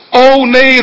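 luide, hoge uitroep van afschuw. Retold or imitated. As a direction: roep het hoog en luid uit. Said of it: "roept hij uit". (luid en hoog uitgeroepen nee)

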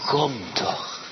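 normal talking voice

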